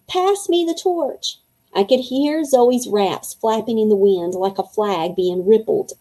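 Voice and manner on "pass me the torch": high, drawn-out mild holler. A narrator's reading. Zoe calling up to Winnie for the torch, mild holler. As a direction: call up to someone without full volume. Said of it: "She hollered up to Winnie".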